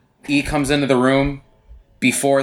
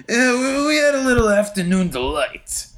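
high-pitched mocking character impression; Drama; 10 semitones higher than usual. Podcaster mimicking a character over-explaining a joke, mock chiming in. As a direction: chime in with a needless explanation, put-on high voice. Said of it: "Drama has to chime in".

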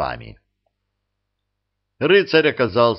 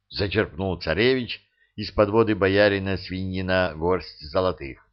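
usual speaking voice

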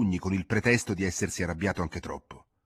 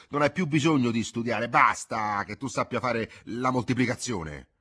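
louder and higher, getting carried away; self-satisfied lecture; 7 semitones higher, 8 dB louder than usual. Acted. Father lectures his son about school, louder and excited. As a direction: preach his opinions to the boy with rising zeal. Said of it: "Domenico se ne esaltava".

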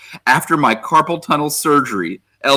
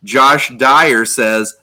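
ordinary talk

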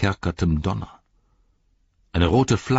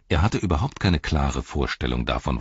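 ordinary delivery, nothing marked

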